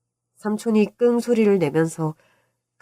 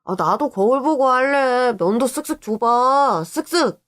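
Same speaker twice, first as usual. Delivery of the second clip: put-on high, drawn-out kid whine; pleading child; 6 semitones higher, slower than usual. Boy begging uncle for a shave, whiny pleading. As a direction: beg slowly in a little boy's voice. Child begs for shaving turn.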